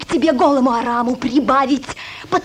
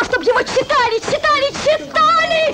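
rising higher on each repeat; building insistence; 8 semitones higher than usual. Acted.

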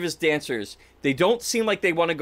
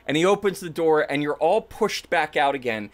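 ordinary talk, nothing marked

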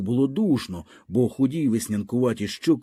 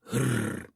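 a low animal growl through the nose; soft throaty growl; 7 dB quieter than usual. A narrator's reading. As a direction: growl like a cornered dog. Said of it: "знов своєї".

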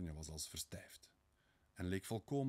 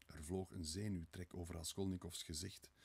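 plain reading voice

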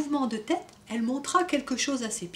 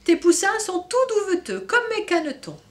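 duck voice; 9 semitones higher, 5 dB louder than usual. Acted. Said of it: "cancana Jeanne".

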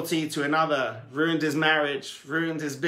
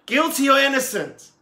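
higher, louder repeat of the question; louder call; 9 semitones higher, 6 dB louder than usual. Acted. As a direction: push it harder the second time. Tzemach Tzedek calls again, more urgently.

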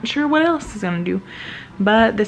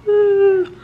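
excited cheer; 12 semitones higher, 3 dB louder than usual. Real, not acted. Proud cheer for mom graduating.

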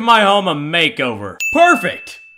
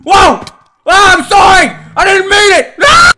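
loud frantic apology, high pitched; panicked shouting; 10 semitones higher, 9 dB louder than usual. Real, not acted. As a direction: yell it out in a panic.

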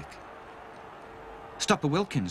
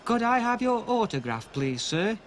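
put-on higher, polite child voice; polite request; 10 semitones higher than usual. Stanley asking the star for an autograph, polite. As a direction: ask nicely like a small boy. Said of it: "in a most polite way".

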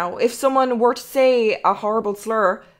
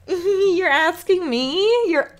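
higher and slow, stretched in disbelief with a laugh; incredulous disbelief; 6 semitones higher, 5 dB louder, slower than usual. Retold or imitated. Reading the OnlyFans email, slow mock disbelief. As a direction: drag it out, stunned they'd ask.

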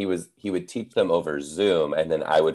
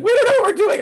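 put-on very high squeaky kid voice; 7 dB louder, much faster than usual. Teacher imitating confused kids in high squeaky voices. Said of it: "They're like".

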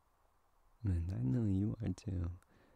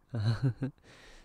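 bright, loud giggle of delight; fond laugh; 3 semitones higher, 5 dB louder than usual. Acted. Laughing at the purring pet in her lap. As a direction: laugh out loud, charmed by the purr.

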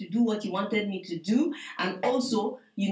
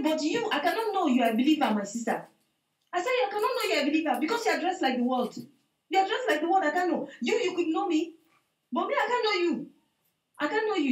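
earnest rebuke; 8 semitones higher than usual. Retold or imitated. Rebuking lady's worldly dress.

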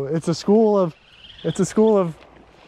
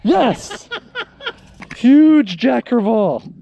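whooping with joy at the catch; excited; 5 semitones higher than usual. Real, not acted. Landing big jack crevalle.

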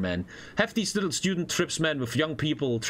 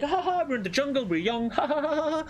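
mock carefree laughter; 10 semitones higher than usual. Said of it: "We're like".

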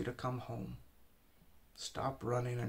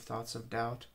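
ordinary talk, nothing marked

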